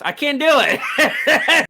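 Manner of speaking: slow, high, loud laugh through the words; laughing give-up. Real, not acted. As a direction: crack up while giving up. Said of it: "This sucks".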